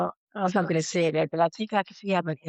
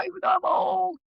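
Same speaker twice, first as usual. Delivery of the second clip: mock inner voice. Grieving daughter voicing her own panicked thoughts, high mock shout. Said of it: "even though I'm thinking".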